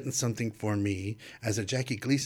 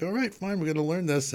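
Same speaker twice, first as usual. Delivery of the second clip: resigned resolve; 8 semitones higher than usual. Retold or imitated. Resolves to learn play.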